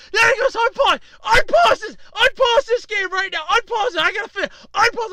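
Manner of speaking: high, loud mock-furious yelling; hammed-up impatient shouting; impatient demand. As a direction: imitate an angry gamer barking demands. Acts out angry player demanding unpause.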